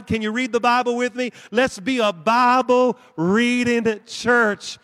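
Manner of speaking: loud, drawn-out preaching climax. Real, not acted. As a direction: build to a rousing peak. Altar-call climax.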